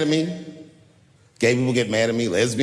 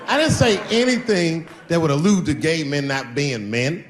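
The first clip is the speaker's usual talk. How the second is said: ordinary talk, nothing marked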